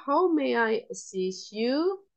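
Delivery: ordinary talk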